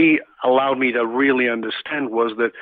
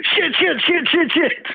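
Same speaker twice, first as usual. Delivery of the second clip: much higher, louder frantic curses, retold; a startled monk swearing as his sleeve catches fire; panicked cursing; 4 dB louder, slower than usual. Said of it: "you started cursing".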